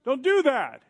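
high, loud protest at the screen; yelling at the computer. Retold or imitated. Man yells at his computer to stop erasing, loud and high. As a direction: shout it at the screen to make it stop. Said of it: "I went".

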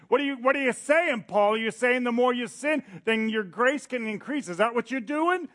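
high, loud incredulous challenge; indignant choir. Retold or imitated. Choir challenges Paul in high, loud disbelief. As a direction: demand an answer, outraged. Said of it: "Paul's responding to their objection".

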